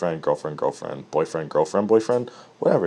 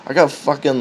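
ordinary talk, nothing marked

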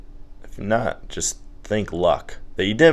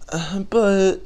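high, feeble protest; mock tearful; 11 semitones higher than usual. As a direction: imitate a boyfriend about to cry. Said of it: "and then you cry".

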